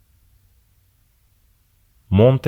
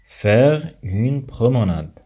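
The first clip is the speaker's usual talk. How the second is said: slow, careful teacher delivery; 2 semitones higher, slower than usual. Shown as a demonstration. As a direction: pronounce every syllable slowly. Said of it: "repeated slowly as a teaching demo".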